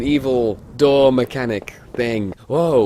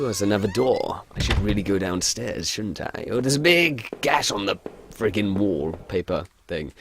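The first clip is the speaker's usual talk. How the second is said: ordinary talk, nothing marked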